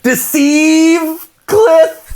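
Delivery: deceive said as "deceive Cliff", slow, high and laughing; drawn out as if Cliff were a name; mock mispronounced deceive. Friend mocks a misheard word, laughing high. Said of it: "So stupid".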